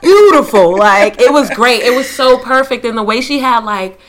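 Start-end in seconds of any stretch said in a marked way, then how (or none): 0.0-0.8 "Beautiful" said louder, falling. Retold or imitated. Echoing grandmother's word to friend, emphatic. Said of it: "she is just like".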